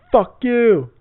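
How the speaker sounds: high, loud mock yell; ranting crackhead. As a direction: yell it like an unhinged street ranter. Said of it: "he talked like a fucking crackhead".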